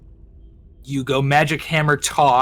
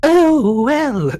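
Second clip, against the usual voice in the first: high, loud swooning oh; gushing queen voice; 12 semitones higher, 4 dB louder than usual. Acted. Fish queen swooning over Victus. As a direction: swoon over the handsome mage.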